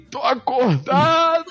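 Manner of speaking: high, slow panicked call; waking up mid-procedure. Retold or imitated. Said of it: "Aí eu falei assim".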